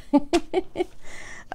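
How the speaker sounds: light laugh. Real, not acted. Laugh after song.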